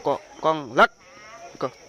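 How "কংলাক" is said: কংলাক said loudly as kong-LAK; loud, split কংলাক for a learner to copy; corrective demonstration. Shown as a demonstration. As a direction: drill কংলাক with a hard final k. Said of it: "দূর বেটা হয় নাই".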